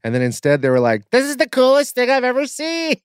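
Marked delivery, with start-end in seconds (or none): 1.1-2.9 high, thrilled gushing; mock-thrilled guest voice; excited guest. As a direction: gush like a delighted tourist.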